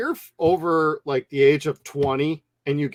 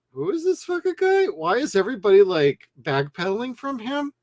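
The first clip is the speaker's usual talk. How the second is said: incredulous; 6 semitones higher than usual. Retold or imitated. Audience reacting in disbelief.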